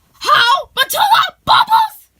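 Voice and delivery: high, loud cries of recognition; amazed reunion shout. Acted. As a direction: call each name in rising excitement. Amazed reunion, shouting friends' names.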